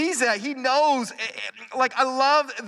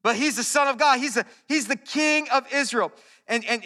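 ordinary talk, nothing marked

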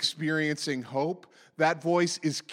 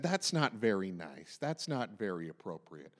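low, hushed disapproval; disapproving church person; 10 semitones lower, 8 dB quieter than usual. Acted. The voice inside us objecting to honesty, disapproving. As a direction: drop low and soft, quietly censorious. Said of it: "that voice that said".

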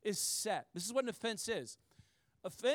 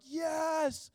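high, loud, happy shout; mock enthusiastic yes; eager yes; 8 semitones higher, 3 dB louder than usual. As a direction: cheer at good news. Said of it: "and you're like".

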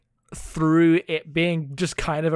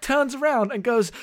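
ordinary talk

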